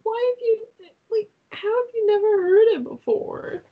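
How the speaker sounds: high, squeaky disbelief; how could you not know; incredulous disbelief. Real, not acted. Disbelief at never hearing phrase.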